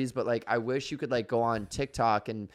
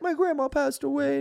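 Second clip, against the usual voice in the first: very high, put-on sad little voice; squeaky high parody of sad news; mock tearful poster; 14 semitones higher than usual. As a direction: imitate a weepy video in falsetto. Mimicking sad TikTok video.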